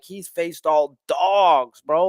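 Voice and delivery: drawn-out, unimpressed imitation of himself; slow dismissive quote. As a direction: slowly wave it off as nothing. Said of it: "And I was like".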